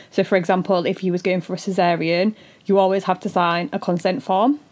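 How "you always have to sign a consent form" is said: usual speaking voice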